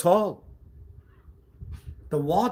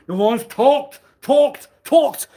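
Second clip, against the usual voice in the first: escalating yell; 13 semitones higher, 11 dB louder, slower than usual. Acted. Repeating word with rising force.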